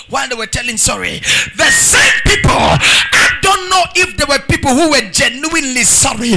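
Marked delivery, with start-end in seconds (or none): 1.6-6.4 loud, slow declamation with drawn-out phrase ends; thundering revival preacher; full-force preaching. Real, not acted. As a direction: drive each line home to the congregation.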